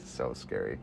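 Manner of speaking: ordinary talk, nothing marked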